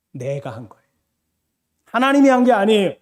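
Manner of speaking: ordinary talk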